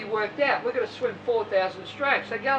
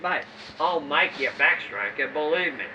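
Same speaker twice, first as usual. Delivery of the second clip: much lower, steady coach's promise; calm reassurance; 8 semitones lower than usual. Retold or imitated. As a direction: promise him low and sure.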